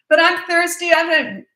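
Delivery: put-on loud, high-pitched kid whine; pleading child. Child yelling out to departed parent, loud high whining. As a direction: call out like a child putting off sleep. Acting a child calling out at bedtime.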